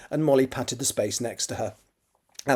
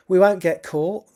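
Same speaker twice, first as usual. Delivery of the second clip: slow, lightly put-on East End London voice; a hint of Cockney, drawn out slowly; East End accent; 6 semitones higher, 6 dB louder, slower than usual. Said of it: "That's my version of an East End accent".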